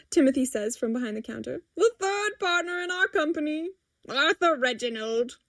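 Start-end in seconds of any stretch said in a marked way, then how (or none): 1.8-5.4 lightly voiced proud announcer; grand introduction. A narrator's reading. Timothy introducing the cat as partner, proudly. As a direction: present the name with ceremony, starting higher.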